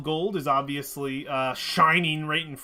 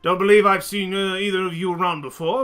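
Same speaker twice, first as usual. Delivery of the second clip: put-on louder, thickly accented Chultan harbor master; 3 semitones higher, 6 dB louder than usual. Harbor master introducing himself to newcomers, thick Chultan accent. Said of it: "it's a thick Charlton accent".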